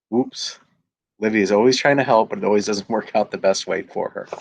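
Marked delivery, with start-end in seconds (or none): 0.1-0.6 whoops. Acted. Mishap in the story.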